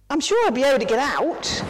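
high, fast little monkey voice; hurried monkey. Acted. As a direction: rush it out like a nervous monkey.

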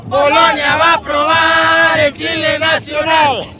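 shouted taunt. Real, not acted. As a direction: shout it at the rivals. Repeated louder.

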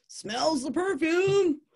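excited storytelling. A narrator's reading. Telling visitors of next male's hopes, high mock excitement. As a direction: build giddy excitement phrase by phrase.

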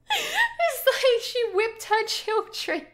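high, slow words squeezed out through laughter; laughing disbelief. Real, not acted. Reacting to the rhyme's ending for viewers, laughing disbelief. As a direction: laugh in shocked amusement as you say it.

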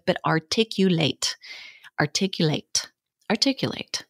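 "articulate" with every syllable crisply separated; over-articulated. Shown as a demonstration. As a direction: spell out "articulate" sharply, each consonant clear. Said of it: "articulate is such a good word to practice with".

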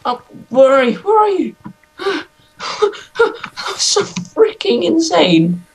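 high, panting gasps between words; out of breath from panic. Real, not acted. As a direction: gasp it out, rattled and high. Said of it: "what are you panting about".

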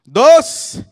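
slow count rising to a yell; high, loud, drawn-out count; shouted countdown. Real, not acted. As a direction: cue the crowd with a big shout. Counts crowd in to cry.

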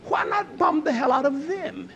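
ordinary talk

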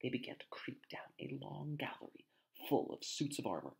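hushed breathy narration; whispering. A narrator's reading. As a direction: whisper it as if hiding. Tense sneaking scene, narrator whispers.

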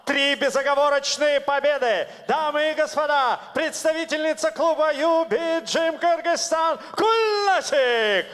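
big, high ring announcer build-up; booming fighting game announcer. Real, not acted. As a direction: hype the fighter's name to the arena.

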